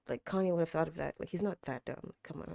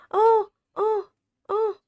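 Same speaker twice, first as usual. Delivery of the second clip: high, loud, crying whimpers; teary cries; 10 dB louder, slower than usual. Real, not acted. Moved by the scene on screen, high crying whimpers. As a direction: let it break into a cry.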